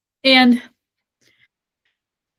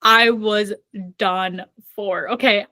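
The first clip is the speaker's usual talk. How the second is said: ordinary talk, nothing marked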